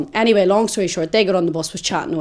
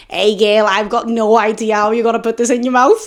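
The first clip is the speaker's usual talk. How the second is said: raised, louder teasing call; cheeky seller; 3 semitones higher, 3 dB louder than usual. Retold or imitated. Baguette seller teasing a customer, cheeky and raised.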